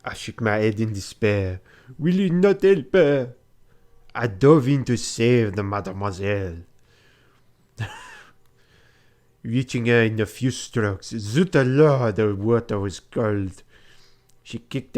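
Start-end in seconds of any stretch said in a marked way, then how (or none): 2.0-3.3 put-on high, loud French-accented plea. Passepartout begs the revelers, loud French accent. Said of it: "the accent wasn't great". 11.1-12.2 slow, loud hammed-up French "zut alors"; French exclamation. Diving into cold water, hammed-up French accent. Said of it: "the accent wasn't great".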